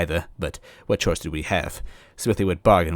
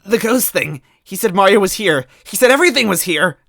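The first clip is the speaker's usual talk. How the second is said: put-on high, louder girlish plea; Toadette pleading; 13 semitones higher, 7 dB louder than usual. Toadette pleading that the ghost sent them here. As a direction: plead desperately. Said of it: "Toadette whimpered".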